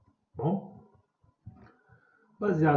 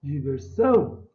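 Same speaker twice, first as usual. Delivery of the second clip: SÃO loud and held in Diversão; calling out; 8 dB louder, slower than usual. Shown as a demonstration. Calling a name at the gate, stressed SÃO in Diversão. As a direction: call "Diversão" out, swelling on SÃO. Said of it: "vou chamar a diversão".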